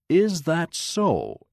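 lightly teasing, drawn-out question; smiling. A narrator's reading. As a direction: draw it out with a smile. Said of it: "Amos smiled".

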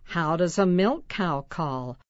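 ordinary delivery, nothing marked

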